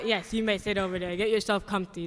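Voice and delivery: ordinary talk, nothing marked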